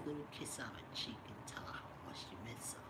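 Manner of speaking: soft unvoiced whisper of advice. Real, not acted. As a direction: coax him gently, whispering. Tender breakup advice to viewer.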